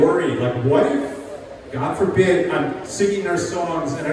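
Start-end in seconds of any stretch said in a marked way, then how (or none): none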